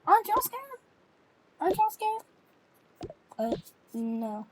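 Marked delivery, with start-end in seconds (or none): 0.1-0.8 high, loud appeal; urgent, scared question. Pretend. Insistent question in acted storm panic. 1.6-2.2 high, loud, repeated appeal; urgent, scared question. Pretend. Repeats the question in acted panic.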